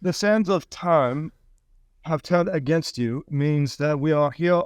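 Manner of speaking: slow, grave oracle tone; slow solemn explanation. Acted. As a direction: draw it out like a mystic.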